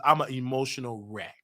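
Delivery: ordinary talk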